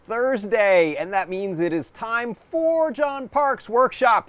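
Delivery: loud, upbeat opener; show host intro. Acted. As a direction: welcome viewers like a host. Show opening greeting.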